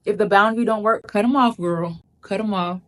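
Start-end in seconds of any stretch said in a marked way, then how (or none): none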